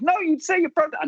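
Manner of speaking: high-pitched retold objection; arguing mother. Mother arguing with father in the car, retold objection. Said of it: "my mother's like".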